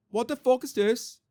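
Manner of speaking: high-pitched, badly faked Liverpool accent. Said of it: "my Liverpool accent is very bad".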